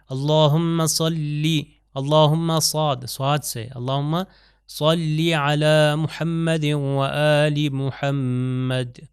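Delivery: صلی with a heavy ص, whole line very slow; slow careful salawat recitation. Shown as a demonstration.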